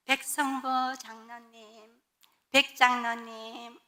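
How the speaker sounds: very slow, drawn-out honorific call; respectful address. Retold or imitated. Church members addressing the elder respectfully, slow and drawn out. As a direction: stretch the title out with deference. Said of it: "이렇게 했는데".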